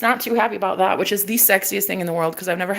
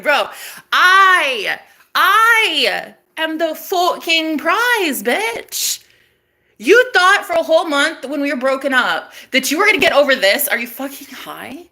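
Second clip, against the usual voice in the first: hammed-up indignation; 6 semitones higher than usual. Woman replaying her comeback to ex, hammed-up indignation. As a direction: proclaim it at him with outraged swagger.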